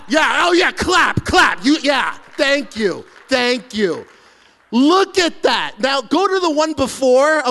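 loud, high, cheering at his own reveal; triumphant excitement. Real, not acted. Pastor shows congregation his cleared patio, loud triumphant cheering. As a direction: celebrate like you won a trophy.

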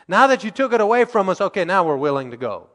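high, loud and fast sulky give-in; mock grudging reply. Preacher mimics Israelites changing their minds, fast, high and whiny. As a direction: toss it off like a sulky crowd. Israelites agree only when it's taken.

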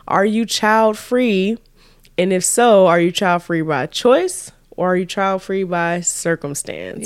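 slow, deliberate question for viewers; slow host question to the comments; posing the question. Shown as a demonstration. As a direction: spell it out to the audience. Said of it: "the question of today is".

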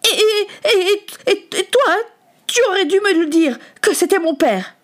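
stammering, high-pitched reproach; distraught and accusing; furious accusation. Acted. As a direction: stutter into a tearful accusation. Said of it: "La fureur que Samuel".

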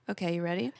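ordinary talk, nothing marked

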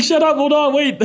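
high-pitched, giggly pleading; imitating laughing brother. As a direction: mimic someone begging for a moment mid-laugh. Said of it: "all I hear is him laughing".

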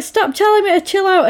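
high-pitched, heated retort; imitating an indignant argument; irritated comeback. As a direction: argue back with pitch shooting up. Acted quarrel in the car.

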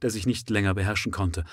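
usual speaking voice

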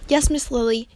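usual speaking voice

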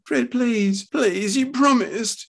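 very high, imploring wife; crying out, pleading. A narrator's reading. Wife begging her husband at the bedside, pleading cry. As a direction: beg him in a high cry. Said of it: "cried Doris".

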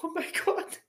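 high, giggling oh my God; giggly mock panic; laughing disbelief. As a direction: laugh through the words at yourself. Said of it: "holding this wee dog going".

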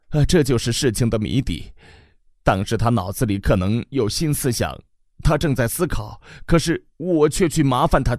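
更高的慈母声, 激动; 又哭又笑地念叨着; 絮叨的母亲. Acted. 母亲谈儿子文章. (激动的母亲絮叨)

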